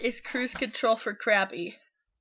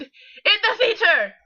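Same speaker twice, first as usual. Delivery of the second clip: louder, raised-pitch dramatic title; yelled all caps; 10 semitones higher, 7 dB louder, slower than usual. Shown as a demonstration. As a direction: read it like it's in capitals. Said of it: "All caps, so I have to yell".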